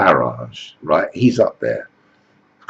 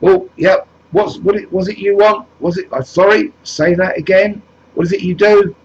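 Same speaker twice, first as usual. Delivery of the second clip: far higher, fumbling, stammering voice; flustered questioner; 11 semitones higher, 3 dB louder than usual. Acted. Jesus Farage fumbles questioning the next migrant, mock flustered. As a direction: lose track and ask again.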